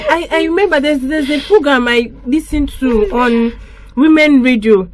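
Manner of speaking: usual speaking voice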